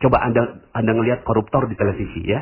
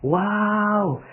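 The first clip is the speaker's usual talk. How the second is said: impressed wow; 9 semitones higher, much slower than usual. Shown as a demonstration. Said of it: "coba Anda ngomongnya gini".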